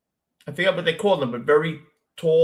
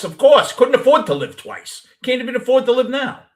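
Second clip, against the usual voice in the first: louder, higher, wound-up joke delivery; comic punchline; 9 semitones higher, 7 dB louder than usual. Real, not acted. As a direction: fire the gag off with rising energy. Punchline joke after YOLO quote.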